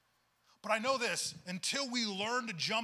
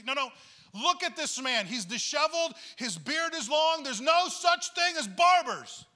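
climbing higher and louder, slowing to a forceful end; building emphatic point; 6 semitones higher, 9 dB louder, slower than usual. Retold or imitated. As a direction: press harder each line until the last is slow and loud. Pastor pressing his point.

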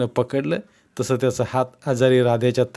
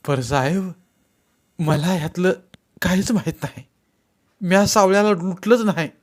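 raised-pitch denial, climbing higher; pleading suspect; 7 semitones higher than usual. A narrator's reading. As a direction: plead ignorance to the officer. Frightened suspect denies the robbery.

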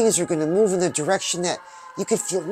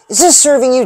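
louder, higher pointed question; emphatic challenge; 5 semitones higher, 8 dB louder, faster than usual. Real, not acted. Host challenging his viewers, loud and pointed. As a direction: put it to them hard.

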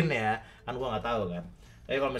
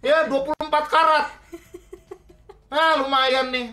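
laughing greedy mother; 13 semitones higher, 6 dB louder than usual. Acted.

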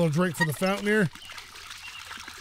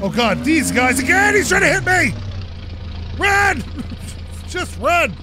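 high, loud panicked cries; rising panic, shouting to flee; panicked yelling; 7 semitones higher, 3 dB louder than usual. Real, not acted. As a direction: yell in real fright as he chases you. Chased by angry NPCs in game.

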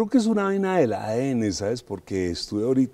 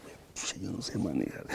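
sheepish confession; 2 semitones lower, 9 dB quieter than usual. Retold or imitated. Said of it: "Y dice".

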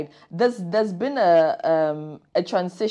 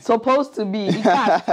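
a laughing jab, a bit louder; laughing tease; 5 semitones higher, 3 dB louder than usual. Real, not acted. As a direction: chuckle as you poke fun. Host mocks the broken promise.

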